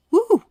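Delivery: high, loud cheering whoop; woo. Real, not acted. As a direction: let out a delighted whoop. Cheering at the reading.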